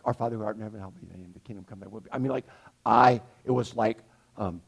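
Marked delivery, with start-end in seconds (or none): 0.0-2.1 rushed mumble. Retold or imitated. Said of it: "they're like".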